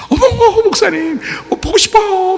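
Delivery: high, gushing squeal of delight; gushing pastor's wife. Retold or imitated. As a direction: coo "목사님" like an excited fan. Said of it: "막 이러면서". (한껏 들뜬 높은 목소리로 반기는 사모님)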